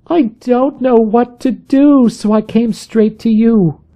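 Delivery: frightened visitor pleading with her friend; distressed plea. A narrator's reading.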